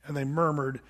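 ordinary talk, nothing marked

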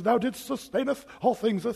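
a hint of pompous old prayer voice; mock King James prayer. Mocking how people pray in King James language, lightly pompous. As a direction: lightly intone archaic holy words. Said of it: "King James language".

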